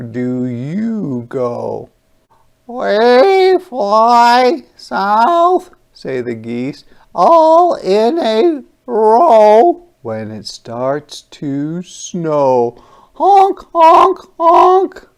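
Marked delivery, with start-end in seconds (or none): 2.7-5.7 high, loud, very slow goose voice; storybook geese announcing. Acted. 7.1-9.8 high, loud, very slow goose voice; storybook geese announcing. Acted. 13.1-15.1 very high, loud honking; honking geese; goose honks. Acted. Geese honk in story.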